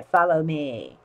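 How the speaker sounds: low, quiet sly whisper; sly sinister fox. Shown as a demonstration. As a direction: lure them in slyly. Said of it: "repeated low after laugh".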